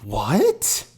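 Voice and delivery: rising incredulous what; disbelieving what. Real, not acted. Reacting to grandma.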